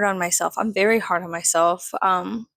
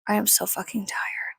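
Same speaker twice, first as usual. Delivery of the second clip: soft, toneless, worn out; tired whisper; 6 dB quieter than usual. Real, not acted. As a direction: let the breath carry it, drained. Exhausted aside.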